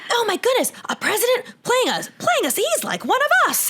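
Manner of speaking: high, squeaky gushing imitation; hammed-up starstruck voter voice; mock amazement. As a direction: gush like an astonished fan, way up high. Voicing public amazement at saxophone-playing candidate.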